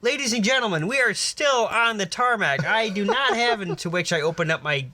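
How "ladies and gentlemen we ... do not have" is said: high, projected appeal to passengers; emphatic public address. Acted. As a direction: declare it to a panicking cabin. Acted address to panicking passengers.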